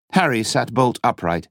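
ordinary delivery

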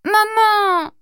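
high wailing call for mum; a toddler's desperate cry for her mother. A narrator's reading. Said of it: "l'inévitable appel désespéré".

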